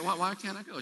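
a small, hushed protest; son's question. Retold or imitated. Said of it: "I said".

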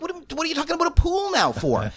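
retold disbelief at the driver; baffled outburst. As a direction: demand what he means, baffled.

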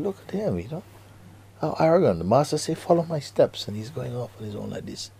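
high-pitched mock indignation; upset. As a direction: complain like an offended disciple. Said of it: "the others became very upset with him".

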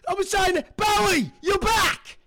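very high-pitched excited cry; thrilled squealing. Real, not acted. Co-host thrilled [name] is back, high excited squeal.

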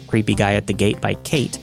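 ordinary delivery, nothing marked

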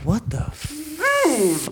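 high, drawn-out stunned reaction; stunned disbelief. Retold or imitated. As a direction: stretch it out, trailing into a hiss. Retells own stunned reaction.

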